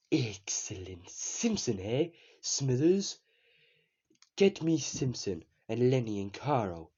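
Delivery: a creaky villainous tycoon summoning his workers; Mister Burns. Shown as a demonstration. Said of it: "I can do an impression of Mister Burns".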